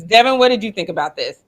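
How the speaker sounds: ordinary delivery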